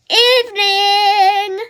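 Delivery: slow, loud, sung-out greeting; called sharply. Acted. Dad coming home from night flying, calling out sharply. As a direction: drag the word out high and loud. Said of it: "called Dad sharply".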